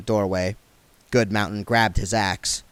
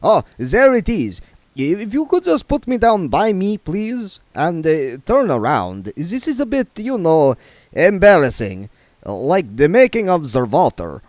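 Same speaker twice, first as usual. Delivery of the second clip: higher, louder, sneering put-on voice; mock the joke with icy sarcasm; offended vampire playing it dry; sarcastic vampire Otto; 8 semitones higher, 6 dB louder than usual. Otto embarrassed.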